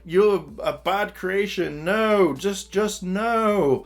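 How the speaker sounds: high, slow recoiling refusal; scared maker. Retold or imitated. Nate as Doctor Frankenstein rejecting Steele, high slow frightened refusal. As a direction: back away from the monster, drawing out the no. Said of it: "And Nate's like".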